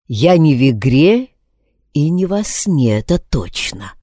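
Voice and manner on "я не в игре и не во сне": ordinary delivery, nothing marked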